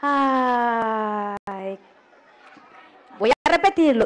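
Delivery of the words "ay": long, falling mock lament; one long sorrowful moan; sad "ay". Shown as a demonstration. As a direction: draw out a low sigh of pity for listeners to copy. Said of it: "Qué triste. Todos vamos a hacer".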